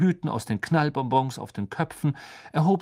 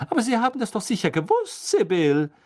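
quick, higher, pointed; skeptical; 9 semitones higher, 4 dB louder than usual. A narrator's reading. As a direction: raise an eyebrow with your voice. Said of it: "mit hochgezogenen Augenbrauen". (skeptisch, spitz)

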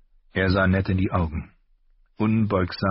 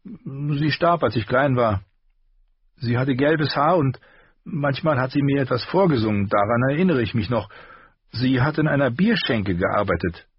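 put-on higher voice for the young apprentice; Gendry's voice; 8 semitones higher than usual. A narrator's reading. Apprentice telling Ned of the questions, higher voice. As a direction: pitch up, keep it eager. Gendry speaks of his mother.

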